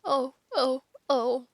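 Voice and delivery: a fawn sobbing, mildly acted. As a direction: wail it out through tears. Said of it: "sobbed Mister Tumnus".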